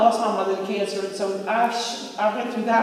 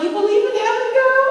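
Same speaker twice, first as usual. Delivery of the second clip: mock surprise; 7 dB louder, faster than usual. Retold reply to dying friend.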